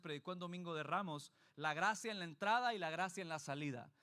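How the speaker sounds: ordinary talk, nothing marked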